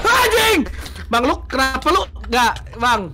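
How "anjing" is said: high, startled swear; sudden high-pitched cry; startled curse. Real, not acted. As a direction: yelp the curse as if just jumped. Curses at a sudden game scare.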